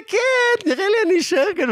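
very high, rushed teasing voice; mock defiant retort. Goading the hipster at the crossing, high mock retort. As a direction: squeak it out fast to provoke him.

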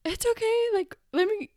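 very high imitation of a fussing mother; flustered comforting mom.

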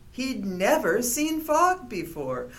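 ordinary delivery

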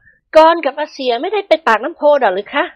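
high, pleading wife; tearful question. A narrator's reading. Praphai asks where her husband went, choked questioning. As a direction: ask anxiously in a high feminine voice. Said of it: "ประภัยพูดเสียงเครือ".

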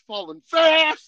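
high, loud, stretched-out "fast"; slow, emphatic holler; excited exclamation. Real, not acted. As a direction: build to a loud punch on the last word. Relives falling too fast.

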